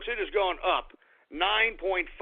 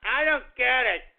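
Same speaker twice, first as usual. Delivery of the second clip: whiny, higher-pitched mocking voice; mock the naysayers in a high whine; whiny complainer; 4 semitones higher than usual. Imitating viewers who question his coverage, whiny mockery. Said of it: "even though people are like".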